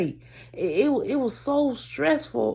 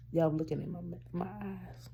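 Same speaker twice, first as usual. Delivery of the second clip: tearful murmur; 4 semitones lower, 13 dB quieter than usual. Real, not acted. Eyes welling up on camera.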